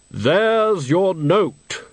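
slow, hollow, solemn deathly voice; Death's voice. Acted. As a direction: speak as grave, patient Death. Death speaks.

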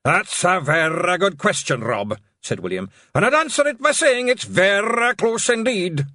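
high, loud pixie brogue; pompous deadpan Scots reply. Acted. William answering Rob, high loud Scots pixie voice.

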